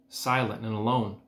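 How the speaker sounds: ordinary delivery, nothing marked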